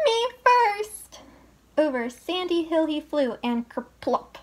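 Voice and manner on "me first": very high, loud "Me first!"; piggy shriek. A narrator's reading. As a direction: shriek it at full gallop. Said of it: "Pinkerton shrieked".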